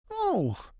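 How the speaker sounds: sharp high gasp of alarm; alarmed cry. Acted. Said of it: "cried Peter Rabbit".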